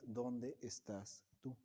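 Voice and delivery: soft and slow, almost a whisper; hushed solemn question. Acted. Preacher softly asks the congregation where they stand. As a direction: let the question hang quietly, drawn out.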